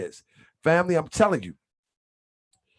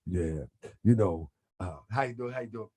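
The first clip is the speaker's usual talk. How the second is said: much lower, softer, easygoing radio host; Grand Sheik radio voice; 9 semitones lower, 8 dB quieter than usual. Retold or imitated. Imitating the Grand Sheik greeting a radio guest, low and soft. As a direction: ease into it like a laid-back interviewer, low and quiet. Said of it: "He's like".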